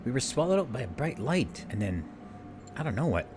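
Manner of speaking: put-on panicked young heroine. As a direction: voice a frightened girl begging for help. Streamer voices panicked game heroine.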